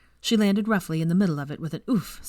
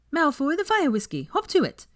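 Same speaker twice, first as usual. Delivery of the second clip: bossy order; 11 semitones higher, 3 dB louder than usual. Acted. Drunk Hermione ordering Malfoy about.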